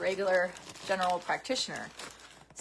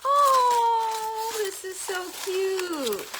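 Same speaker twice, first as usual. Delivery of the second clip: high, squealing delight, slowed on cute; 13 semitones higher, 5 dB louder, much slower than usual. Real, not acted. Showing viewers a garden gnome gift, squealing high with delight. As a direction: gush over the gift in a high voice.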